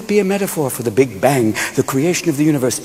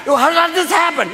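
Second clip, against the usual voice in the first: high, blustering mock shout; exasperated husband hollering, pitched high; Ralph Kramden; 13 semitones higher than usual. Joseph reacting to Mary's pregnancy, blustering high shout. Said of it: "turned Joseph into Ralph Kramden".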